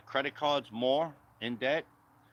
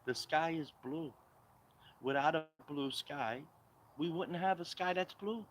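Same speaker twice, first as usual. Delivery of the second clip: mocking Kamala Harris; 4 semitones higher, 3 dB quieter than usual. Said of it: "She talks to you like".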